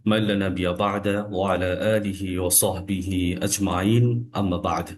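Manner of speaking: slow, measured Quranic recitation; low, solemn opening recitation in Arabic; Arabic invocation. Real, not acted.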